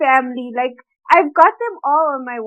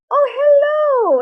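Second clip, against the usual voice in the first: high, slow, sing-song hello; aunt's fake sweet hello; 12 semitones higher, slower than usual. Aunt calling on the phone, mock-sweet and high. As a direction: greet like a nosy aunt fishing for gossip. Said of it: "she's like".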